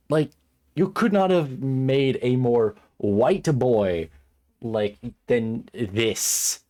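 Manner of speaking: loud, very slow, emphatic complaint; exasperated rant. Real, not acted. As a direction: stretch out each word in exasperation. Rant about the character goes on.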